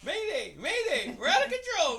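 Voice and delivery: high, very loud frantic yelling; mock distress call. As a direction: yell like a pilot going down. Co-host plays a distress call.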